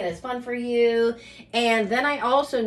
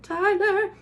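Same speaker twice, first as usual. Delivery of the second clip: high call across the house; 10 semitones higher than usual. Real, not acted. Calling her son from the kitchen, a high call.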